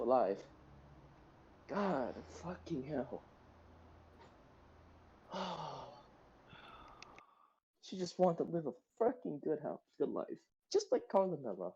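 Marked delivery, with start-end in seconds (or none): 1.7-11.7 mourning a dead character, voice sinking away; grieving. Real, not acted. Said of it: "Crying over a fictional character".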